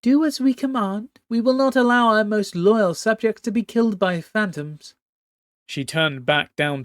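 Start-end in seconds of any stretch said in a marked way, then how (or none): 0.0-4.9 high regal voice, commanding and cold; imperious empress. Acted. Empress Lawan overruling her nervous captain, haughty high command. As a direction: speak as a haughty sea empress giving orders.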